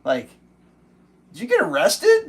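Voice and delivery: high, startled what; shocked disbelief. Retold or imitated. Hearing a friend got arrested, shocked disbelief. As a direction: blurt it out in total disbelief.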